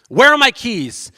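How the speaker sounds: husband yelling for lost keys; loud, stretched-out demand. Retold or imitated. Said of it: "I start yelling at my wife".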